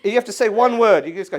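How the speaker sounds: ordinary talk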